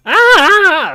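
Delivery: loud, high "ah" of frustration; exasperated yell. Real, not acted. Gamer fed up with his own reading, exasperated yell.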